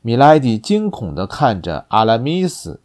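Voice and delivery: usual speaking voice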